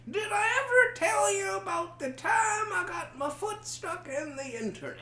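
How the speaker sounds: high, whiny storyteller voice; sing-song high quoting voice; Bill Cosby impression. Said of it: "What did he say?".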